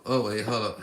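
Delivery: ordinary talk, nothing marked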